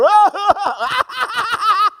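very high, exaggerated cackle; hammed-up burst of high ha-ha-ha; mocking crowd laughter. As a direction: imitate a jeering crowd with shrill laughter. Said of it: "The crowd laughed at him".

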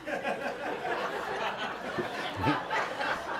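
breathy, high laugh at himself; real laugh. Real, not acted. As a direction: let a real laugh break out. Laughing at own story.

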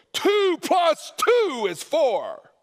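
high, slow, loud shout; yelled. Shown as a demonstration. Preacher yelling a simple truth at the congregation, shouting. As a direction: bellow it like an angry preacher. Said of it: "truth doesn't have to be yelled".

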